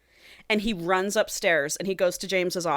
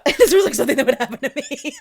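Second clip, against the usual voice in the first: higher, louder, laughing through the words; 6 semitones higher, 5 dB louder, faster than usual. Real, not acted. Friend cracking up as they echo the co-host, laughing. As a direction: crack up while saying it. Laughs while echoing co-host's remark.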